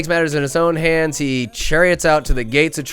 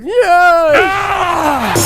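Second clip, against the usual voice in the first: a sudden high, loud yell of delight; hyped-up fan yell; excited whoop; 7 dB louder than usual. Retold or imitated. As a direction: cheer the kill like a fan. Cheering the kill in the recap.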